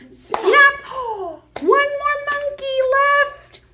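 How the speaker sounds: plain reading voice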